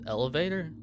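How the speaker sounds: ordinary talk, nothing marked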